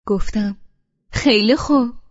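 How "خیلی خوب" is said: angry. Acted. Angry wife gets out of car.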